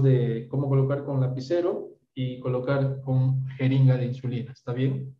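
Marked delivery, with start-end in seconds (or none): none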